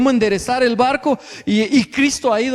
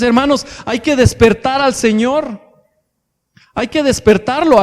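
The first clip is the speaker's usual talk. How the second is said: normal talking voice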